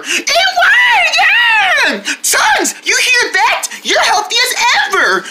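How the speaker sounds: high, excited cheering for the dog. Pretend. Owners cheering their dog after the vet visit, high and excited.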